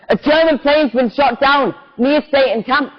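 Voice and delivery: mild shout, pitched well up; excited shout. Acted.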